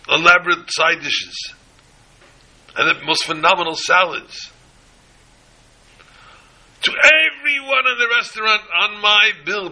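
high, loud furious orders to the waiter; fuming. Retold or imitated. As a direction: bark out the orders in a rage. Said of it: "He's fuming".

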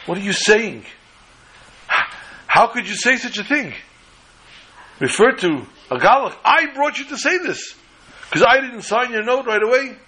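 high-pitched, distressed disbelief; appalled outcry. Retold or imitated. Rabbi stunned by the fellow's words, appalled. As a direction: reproach as if sickened by what was said. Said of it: "was sick over this".

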